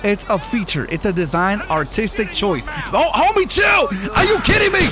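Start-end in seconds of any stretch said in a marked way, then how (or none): 2.9-4.9 startled yell. Real, not acted. Driving chaos, yelling at game.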